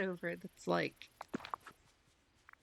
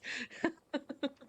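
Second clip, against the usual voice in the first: giggle; 6 semitones higher than usual. Real, not acted. Giggling after stalker joke.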